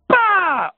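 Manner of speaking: very high, loud "pa"; imitated explosion bang. Survivor recreating another explosion by the phone, loud sharp bang. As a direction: burst out with a sudden blast noise.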